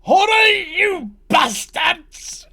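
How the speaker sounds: screamed high and loud, redcap yelling; furious shout. Acted. Redcap shouting at his kin to hurry, furious yell. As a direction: bellow at the others to hurry. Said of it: "And then you hear a".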